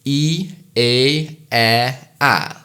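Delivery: E, A, E, A stepping the tongue down; vowels spoken one by one, tongue lowering; vowel sequence demo. Shown as a demonstration. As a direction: glide down through E and A. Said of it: "Now all in one go".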